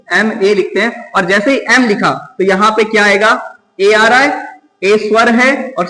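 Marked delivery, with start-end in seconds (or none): 0.1-1.1 एमए said slowly as em-ay, starting on the vowel e; slow clear pronunciation. Shown as a demonstration. Teacher models how to say एमए for students, slow and careful. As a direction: spell out एमए slowly for the class. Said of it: "इसका pronunciation सही से पता होना चाहिए".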